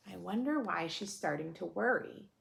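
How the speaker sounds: plain reading voice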